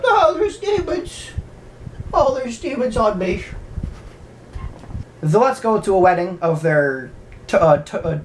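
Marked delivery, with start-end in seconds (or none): 0.0-3.4 panicky priest. Retold or imitated. Priest mocked as he panics about demons on him, high and squealing. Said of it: "He's like".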